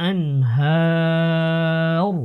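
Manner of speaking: আনহার with a very long sustained a, ending on bare r; slow chanted stop on আনহার; pausal ending. Shown as a demonstration. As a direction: stretch the a in আনহার, then stop on r without a vowel. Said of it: "ওয়াকফ করলে আনহার বলতে হবে".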